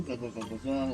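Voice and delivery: normal talking voice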